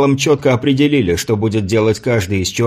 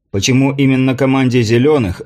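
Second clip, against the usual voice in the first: usual speaking voice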